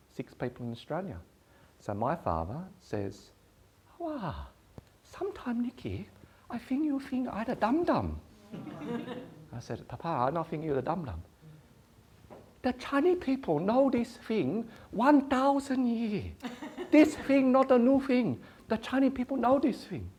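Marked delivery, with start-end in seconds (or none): 4.0-9.6 high, broken-English father voice, teasing; imitating her father. Father voiced teasing his daughter. 9.9-20.1 proud father, louder and higher, rubbing it in; mock Chinese dad. Quoted argument with father, yin yang.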